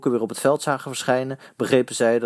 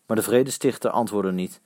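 ordinary delivery

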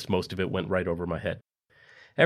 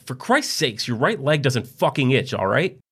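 louder, higher exasperated snap; 5 semitones higher, 5 dB louder, slower than usual. A narrator's reading. Fed-up wife snapping at her husband. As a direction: lose your patience. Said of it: "Karen had enough".

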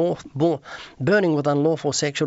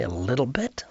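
softer, rising disbelieving echo; incredulous echo; 4 semitones lower, 5 dB quieter than usual. Retold or imitated. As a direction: hand their words back as a question, quietly. He echoes their answer in disbelief.

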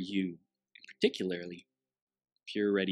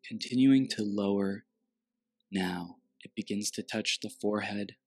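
guided meditation. Acted.

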